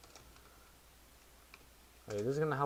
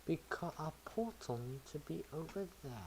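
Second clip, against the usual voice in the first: put-on a quick, half-hearted British accent, pitched higher; 7 semitones higher, 4 dB quieter than usual. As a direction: toss off the line in a mock British accent. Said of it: "tried doing a British accent".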